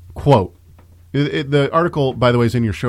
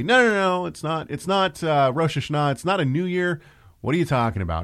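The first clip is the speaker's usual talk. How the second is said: high mock protest, pitched up well above his own; mock protesting Messianic; 6 semitones higher than usual. Mocking Messianics who object to the new year, high whiny protest. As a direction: play an indignant objector, pitched up. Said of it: "continue to say".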